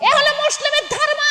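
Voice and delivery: high, ringing proclamation. Acted. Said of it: "জোরে বলেন".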